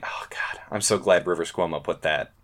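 ordinary delivery

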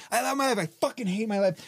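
high affirmation swinging into a curse; retold car argument with oneself; going back and forth. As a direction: flip from forced cheer to venting. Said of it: "I'm going back and forth".

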